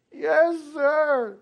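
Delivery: put-on high, loud little boy's answer. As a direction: answer like a scared kid. Boy answers voiced high.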